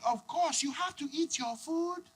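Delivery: high, soft, slow motherly coaxing; high-pitched, measured mum voice; African mom. Acted. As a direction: imitate an accented mother instructing her child. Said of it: "And the mom's like".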